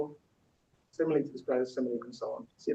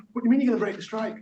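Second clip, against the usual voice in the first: fast, higher indignant challenge; quick, pitched-up stage outrage; acted indignation; 7 semitones higher, 5 dB louder, much faster than usual. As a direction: confront a strikebreaker in a play. Acted quarrel over breaking strike.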